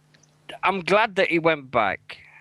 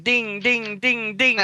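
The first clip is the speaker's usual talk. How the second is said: higher sing-song dings like a notification chime; playful higher imitation of message alerts pinging; phone ding imitation; 8 semitones higher, slower than usual. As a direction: mimic the phone chiming over and over, pitched up. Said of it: "my phone was going ding".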